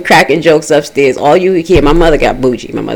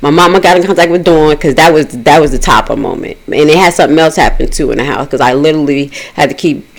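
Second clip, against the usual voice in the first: ordinary delivery, nothing marked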